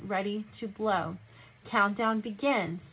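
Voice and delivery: ordinary delivery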